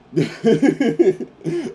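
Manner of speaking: sudden high burst of laughter; loud laugh. Real, not acted. Laughs at guest's reply.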